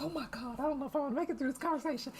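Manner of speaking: high, soft and rushed, half-whispered dread; panicked inner voice. Retold or imitated. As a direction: blurt the worry fast under your breath. Panicked thought in the chair.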